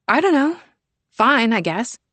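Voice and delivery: put-on a teen who can't be bothered; offhand teen daughter. Sienna shrugs off the question.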